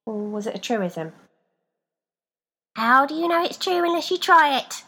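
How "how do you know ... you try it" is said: much higher, louder doubled voice; two voices at once. A narrator's reading. Feet challenging Rebecca, doubled voice. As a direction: speak as two. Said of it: "said to her in two voices".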